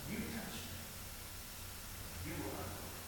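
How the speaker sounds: whispered, gentle rebuke; hushed Jesus voice. Acted. Jesus whispering to Simon, hushed. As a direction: breathe it softly as Jesus to Simon.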